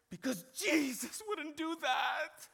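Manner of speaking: high, slow mock kid wail; protesting child. Son stops and answers dad, high childish protest. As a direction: stretch it out high like an upset little boy. Son's tearful retort, acted.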